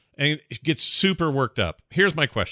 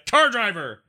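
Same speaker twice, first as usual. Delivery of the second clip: mock insult; 10 semitones higher than usual. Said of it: "It's a hyphenated word".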